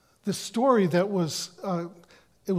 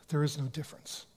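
hushed repeat; 2 semitones lower, 8 dB quieter, faster than usual. Shown as a demonstration. Preacher repeating the answer to his congregation, hushed. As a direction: drop to a soft, hushed repeat for emphasis. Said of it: "That's a trick question".

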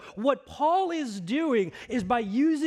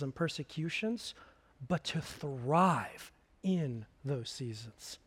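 low, soft, unhurried sermon voice; soft intimate preaching; 6 semitones lower, 5 dB quieter than usual. Acted. Pastor confiding to the congregation, low and soft. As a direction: draw the congregation in quietly.

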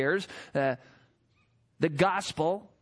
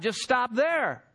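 ordinary delivery

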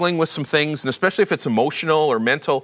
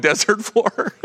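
ordinary talk

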